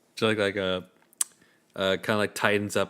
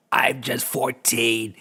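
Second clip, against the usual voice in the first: slow creaky villager; 2 semitones higher, much slower than usual. Acted.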